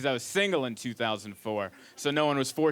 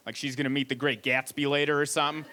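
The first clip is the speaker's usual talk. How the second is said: ordinary delivery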